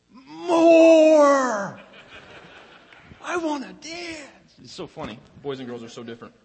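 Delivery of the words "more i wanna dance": put-on high, loud little-girl squeal, slow on the second part; dad imitating his toddler begging for more dancing; excited toddler. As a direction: squeal it like a thrilled two-year-old. Dad voices toddler Maya's reaction.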